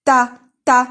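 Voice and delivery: "Ta" loud and short, set apart; isolated syllable. Shown as a demonstration. Teacher singling out the key syllable, "Ta" punched loud. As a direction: punch "Ta" twice as a separate syllable. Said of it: "Syllabe اللي تهمنا هي T A".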